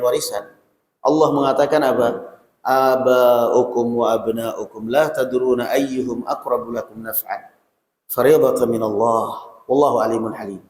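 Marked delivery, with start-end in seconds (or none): 2.6-10.6 slow, measured recitation of a verse; Quran recitation. Acted. Preacher reciting a verse to the congregation, slow and reverent.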